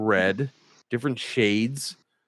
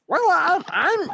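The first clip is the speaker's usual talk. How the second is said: tiny tinkling fairy voice, much higher; weird fairy voice. Shown as a demonstration. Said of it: "You guys like hearing a weird fairy voice?".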